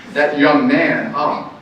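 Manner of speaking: ordinary talk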